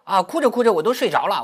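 ordinary talk

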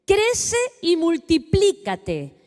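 preacher reading scripture slowly to the congregation. Acted. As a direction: read God's words slowly and weightily. Reads Genesis passage aloud.

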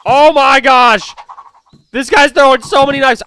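loud, high, stretched panic; real panic under fire; alarmed. Real, not acted. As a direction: cry out as the knives fly. Enemy throwing knives.

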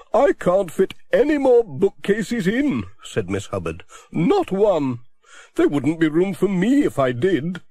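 a chatty spinster complaining cheerfully; voiced Miss Hubbard.

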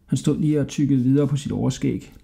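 ordinary delivery, nothing marked

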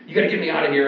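imitating frantic disciples; half-screamed mock panic; mock panicked yelling. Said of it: "they're running around and they're screaming".

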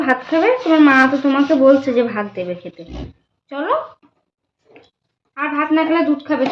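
high, loud sweet-talking. Real, not acted. Mother sweet-talking her son to come eat, high loud baby talk.

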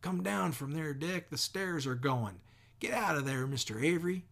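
men shouting up at a neighbour in a burning house. Acted. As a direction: yell up at a man in danger. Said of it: "men shouted".

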